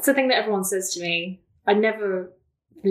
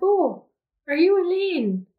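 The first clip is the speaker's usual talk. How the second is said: mock surprised greeting; 11 semitones higher, 3 dB louder than usual. Imitating Mary's surprised greeting.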